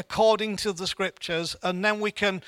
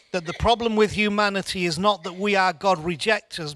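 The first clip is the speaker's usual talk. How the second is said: ordinary talk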